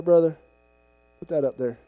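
normal talking voice

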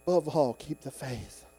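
soft, restrained urging; quiet exhortation. Acted. Preacher closing Paul's words for the church, quiet exhortation.

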